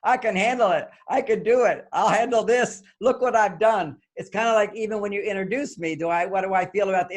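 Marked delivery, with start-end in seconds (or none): 0.0-4.0 higher, louder, boastful, confident voice; Donald Trump. Retold or imitated. Speaker pitching to men as Trump, loud bragging. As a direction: puff up and brag at full volume. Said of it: "I'll be Donald Trump".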